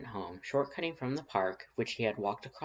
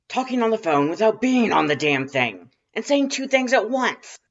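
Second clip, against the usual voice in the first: higher, much louder outraged yelling; 12 semitones higher, 14 dB louder than usual. A narrator's reading. Crude caller ranting at someone else, loud outraged yell. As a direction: rant at full volume. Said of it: "It was a loud voice".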